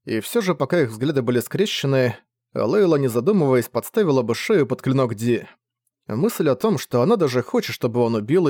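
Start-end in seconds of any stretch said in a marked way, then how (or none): none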